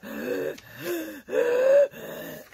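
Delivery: a high, rasping gasp for air; imitated wheezing gasp. Telling viewers how her husband gasped for air, imitated wheezing gasp. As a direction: mimic a man gasping for breath. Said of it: "that man was out of breath so bad".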